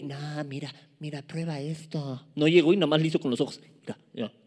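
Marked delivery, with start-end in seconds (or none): 0.0-2.3 soft, hushed coaxing voice; tempting serpent. Acted. Actúa la serpiente que tienta. 3.8-4.3 soft, low coaxing murmur; lightly voiced tempter, low and hushed; tempting serpent. Imita a la serpiente tentadora.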